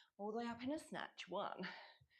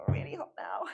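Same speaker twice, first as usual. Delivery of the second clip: whispering; 13 semitones lower, 10 dB louder than usual. Real, not acted. Whispered aside after the set.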